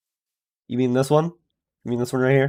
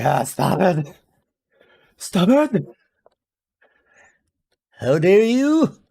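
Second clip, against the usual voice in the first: Mister Crocker impression; 6 semitones higher than usual. Streamer doing the villain after a joke backfires. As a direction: shriek like an offended schemer. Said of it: "my Mister Crocker impression".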